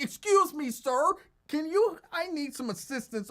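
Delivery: high, loud, prissy mock-polite plea; mock polite request. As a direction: ham up an overly proper request for help. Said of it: "you're gonna be like".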